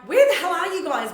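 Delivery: mock-angry call to the friends; exasperated yell. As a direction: shout down the phone at your friends. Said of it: "I called them and I was like".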